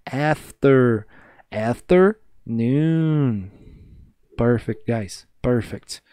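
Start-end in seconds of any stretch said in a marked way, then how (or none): 0.0-3.5 afternoon said very slowly; drawn-out model. Shown as a demonstration. Teacher modelling the hard word, very slow. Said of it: "a mais chata de todas".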